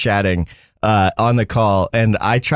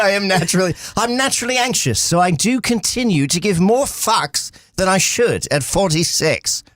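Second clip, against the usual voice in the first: hammed-up British accent, higher; 6 semitones higher than usual. Host rereads a chat message in mock British accent.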